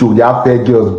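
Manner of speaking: ordinary talk, nothing marked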